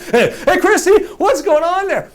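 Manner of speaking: high, pushy mock-friendly call; eager hailing. Boy trying to get a kid's attention, overfriendly hail. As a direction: hail the kid with forced cheer. Calling the kid for a trade.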